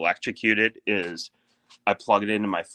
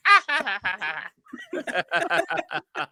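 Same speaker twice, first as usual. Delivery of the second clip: much higher mock cackle of the workers; imitated carefree laughter; 13 semitones higher, faster than usual. Storyteller mimics workers laughing off falling debris, high mock laughter. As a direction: ham up their careless laughing. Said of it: "And they're like".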